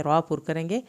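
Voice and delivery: normal talking voice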